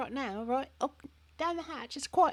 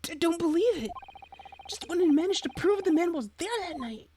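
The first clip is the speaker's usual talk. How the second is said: defense attorney voice; 4 semitones higher, 3 dB louder than usual. Acted.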